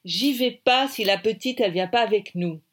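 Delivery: firm refusal. A narrator's reading. Heroine firmly refuses to leave.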